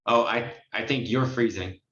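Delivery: ordinary talk, nothing marked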